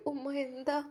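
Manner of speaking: a quiet startled question; shocked. A narrator's reading. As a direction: catch your breath in surprise. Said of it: "അവൾ ഞെട്ടിപ്പോയി". (ഞെട്ടലോടെ ചോദിക്കുന്നു)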